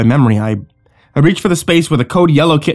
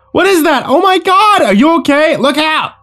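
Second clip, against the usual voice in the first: high-pitched alarmed outburst; panicked cry; 3 dB louder than usual. Acted. Friends attacked by raccoons in a dark hallway, panicked cries.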